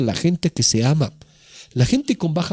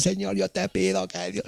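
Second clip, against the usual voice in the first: higher, softer parody of gushing prayer; mock prayer bombardment; 5 semitones higher, 9 dB quieter than usual. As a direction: imitate someone pouring out a pleading prayer. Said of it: "hacemos un bombardeo de oraciones". (parodia de oración atropellada, aguda y bajita)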